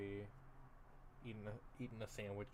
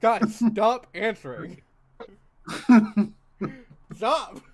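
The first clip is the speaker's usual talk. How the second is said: laughing protest; 10 semitones higher, 18 dB louder, slower than usual. Real, not acted. Host scolding chat, laughing.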